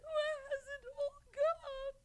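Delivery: high, weeping lament that keeps breaking; tearful despair. Acted. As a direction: cry out the loss of everything. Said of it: "Don't cry, child".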